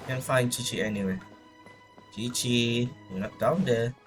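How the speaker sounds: usual speaking voice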